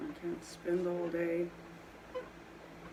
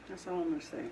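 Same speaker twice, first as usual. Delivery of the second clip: ordinary delivery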